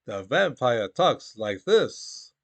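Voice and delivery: put-on slow, drawn-out Hungarian-accented vampire line; Bela Lugosi's Hungarian accent. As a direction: spell it out slowly in a thick Hungarian Dracula accent. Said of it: "That's the way he really talked in English".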